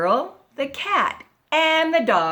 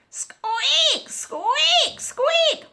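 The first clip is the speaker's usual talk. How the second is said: high-pitched drawn-out bed squeaks; squeaky bed; much slower than usual. A narrator's reading. Storyteller voices the creaky bed for listeners, high squeaks. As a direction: squeal each squeak up high like old springs. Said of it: "the bed began to squeak".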